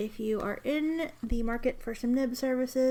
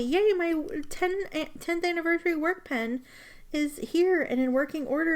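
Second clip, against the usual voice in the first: squealing yay; 6 semitones higher, 4 dB louder than usual. Real, not acted. Celebrating the working pen.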